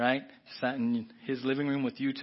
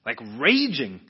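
RAGING punched much louder; heavy emphasis; 10 dB louder, slower than usual. Real, not acted. Preacher stressing how angry he was, "raging" hit hard. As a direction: lean hard on the word "raging".

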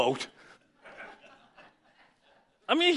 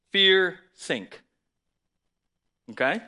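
ordinary talk, nothing marked

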